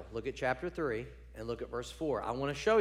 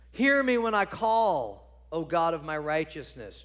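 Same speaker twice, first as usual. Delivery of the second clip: declaimed psalm; 2 semitones higher, 6 dB louder than usual. Acted. Preacher reading David's prayer to the congregation, loud declaiming.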